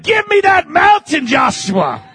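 Caleb's bold demand. Retold or imitated. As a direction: claim it with fire. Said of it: "Except Caleb said".

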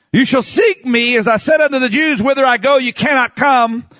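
high-pitched, emphatic pulpit delivery; fiery preaching. Acted. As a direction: read scripture aloud with preaching fervor. Preaches at full force.

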